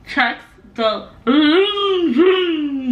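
very slow, laughing through a mouthpiece; drawn out through a mouthpiece; mouth propped open. Real, not acted.

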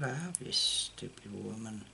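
ordinary talk, nothing marked

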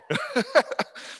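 a high, embarrassed giggle; sheepish laugh. Real, not acted. Admitting the gun shop trips, sheepish high laugh. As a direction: laugh it off, caught out.

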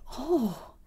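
high, falling gasp of delight; rapturous. A narrator's reading. Wendy hears of riding the wind, exclaims rapturously. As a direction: breathe out an enraptured exclamation. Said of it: "she exclaimed rapturously".

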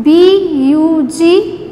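letters spelt out slowly one by one; slow spelling drill. Shown as a demonstration. Teacher spelling a word slowly for children to repeat. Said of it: "You have to repeat every word twice with me".

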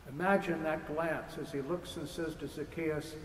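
ordinary talk, nothing marked

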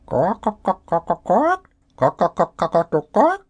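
high squawking bird calls, rising; hen cackling. Acted. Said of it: "Surely it was a hen cackling".

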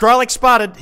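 loud, high announcement of a sighting; excited call-out. Real, not acted. Streamer spots Grolix in a setup, excited call-out. Calls out a spotted person.

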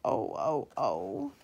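low, wailing oh; low mournful cry of cars; freight cars crying. Acted. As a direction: moan it like bumped cars. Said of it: "making them cry".

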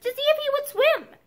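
put-on high small-boy voice with a slight tremor; nervous child's high-pitched explanation; little tremor. A narrator's reading. Boy explaining himself to his father, slightly tremulous. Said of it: "with a little tremor in his voice".

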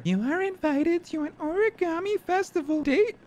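very high falsetto letter voice; high, drawn-out put-on voice; invitation read-out.

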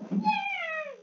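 very high, soft squeal of joy; little cheer. Real, not acted. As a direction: squeak out a small, quiet cheer. Small cheer during game.

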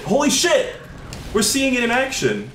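high-pitched exclamation of disbelief; real startled excitement, high; amazed outburst. Real, not acted. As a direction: cry out in astonishment, voice way up. Amazed at teleport glitch on camera.

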